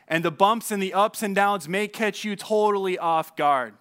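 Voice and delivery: ordinary delivery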